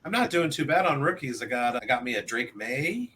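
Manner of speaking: ordinary delivery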